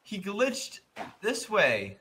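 high, stunned voice; amazed. Real, not acted. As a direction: gush in disbelief. Amazed after glitch.

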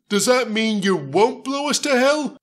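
high hopeful nervous ask; hopeful question. Acted. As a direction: ask hoping for mercy. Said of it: "James asked hopefully".

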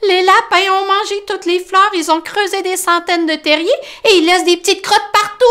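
high, loud aggrieved mole; Tom complaining. Acted. Tom listing the rabbits' mess, high complaining voice. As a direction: list the damage in dismay.

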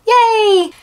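high, loud little yay; excited cheer. Real, not acted. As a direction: cheer it out brightly. Cheering about new piercing.

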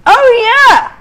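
loud, high burst of joy; excited cheer. Real, not acted. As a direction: celebrate out loud. Cake comes out well.

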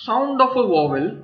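slow, spaced English phrase; deliberate teacher read-out; slow definition read. Shown as a demonstration. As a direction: spell the definition out for the class. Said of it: "teacher reads definition slowly".

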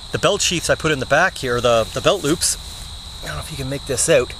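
normal talking voice

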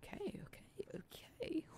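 soft, hushed whisper to oneself. Real, not acted. Gamer whispering to self, whispered. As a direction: whisper it under your breath.

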